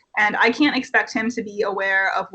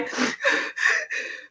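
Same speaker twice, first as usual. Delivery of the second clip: high, breathy whimpering gasp; mock sob gasp; 5 dB quieter than usual. Director mocking the camper's crying to his face, fake sob gasp. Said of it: "he starts mocking my sobs".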